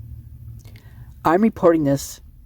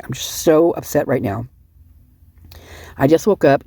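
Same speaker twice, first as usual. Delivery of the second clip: ordinary delivery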